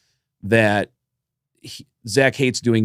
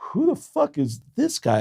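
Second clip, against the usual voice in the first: much higher, bewildered retold question; puzzled disbelief; 4 dB quieter than usual. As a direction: act baffled by the photos. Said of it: "I'm going".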